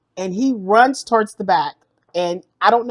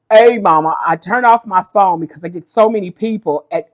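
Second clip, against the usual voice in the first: ordinary delivery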